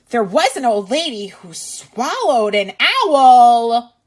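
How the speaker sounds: put-on reading to children. Teacher reading a picture book to kids, lively and loud. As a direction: read it out big for little listeners. Reads rhyming book aloud.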